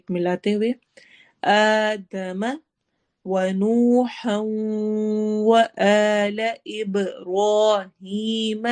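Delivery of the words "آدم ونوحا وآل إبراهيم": آدم ونوحا وآل إبراهيم chanted slowly, nasal merge in ونوحا; slow recitation with ghunna. Shown as a demonstration. Teacher reciting the list of prophets, drawn-out chant. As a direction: merge the noon into the و with a hum. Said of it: "و ادغام، غنہ کے ساتھ".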